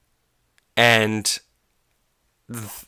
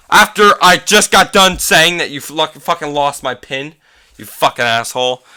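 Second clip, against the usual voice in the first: playful scolding; 5 semitones higher, 5 dB louder than usual. Real, not acted. Scolding friend mid-podcast.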